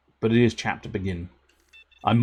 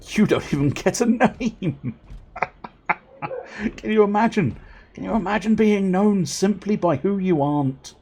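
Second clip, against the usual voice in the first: high, loud incredulous teasing; gleeful mockery; 10 semitones higher, 6 dB louder than usual. Player teases Not Barry over his name, gleefully mocking.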